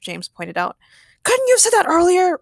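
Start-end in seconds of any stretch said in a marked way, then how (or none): 1.2-2.4 high, loud irritated retort; loud, indignant question; shooting back. Acted. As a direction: fire back in exasperation. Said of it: "Ray shot back".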